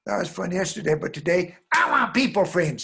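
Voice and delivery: refuse, rising to a loud wail; demanding little girl. Acted. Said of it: "The girl says".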